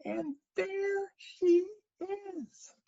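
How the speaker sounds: very high, slowly drawn-out squeak; crying Baby Bear. Acted. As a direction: stretch each word in a tiny cub voice. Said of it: "cried the Baby Bear".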